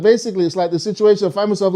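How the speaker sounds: ordinary talk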